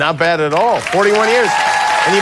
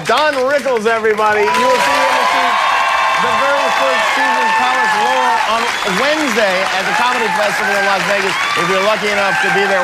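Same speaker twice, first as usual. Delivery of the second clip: higher, brisk announcer read; TV host sign-off; 6 semitones higher than usual. Real, not acted. Talk show host sending the studio audience to a break, brisk and higher. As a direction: sell the upcoming guests.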